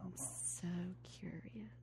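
seductive whisper; soft breathy tease. Pretend.

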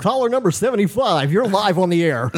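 high, punchy radio call-in host. Pretend. Host greeting a caller on air, radio voice. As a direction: announce it like a live radio host. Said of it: "I love your radio voice".

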